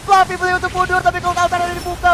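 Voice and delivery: high, fast, rising hype commentary; fighting game announcer. Acted. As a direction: call the teamfight at full hype. Rising live play-by-play on teamfight.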